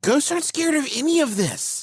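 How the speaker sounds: high, soft-voiced protest; incredulous protest. Real, not acted. Co-host objects to scaring ghosts, incredulous protest.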